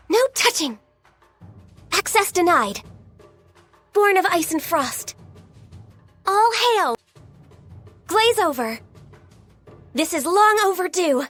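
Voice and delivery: high-pitched combat call-outs; short, high fighting exclamations; battle cry. Acted. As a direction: call out attacks with determination.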